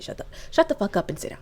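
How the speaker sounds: fast whispered mother's scold; hushed mama scolding. Retold or imitated. Mother hushing her rude child, fast whisper. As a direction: hiss it like a mom at church. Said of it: "Your mama should tell you".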